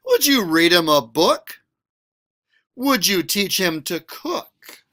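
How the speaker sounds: lively, sing-song picture-book reading; reading to children. A narrator's reading. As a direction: read it aloud to a class, playful and bouncy.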